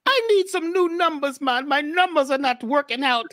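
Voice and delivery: put-on high-pitched, joking Jamafrican accent; fake Jamafrican accent. Caller jokingly begging King Simon for numbers, fake Jamafrican accent. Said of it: "fake Jamafrican accent".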